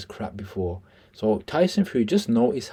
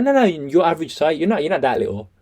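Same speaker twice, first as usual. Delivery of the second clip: hurried protest; 8 semitones higher, 5 dB louder than usual. Retold or imitated. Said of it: "and he's like".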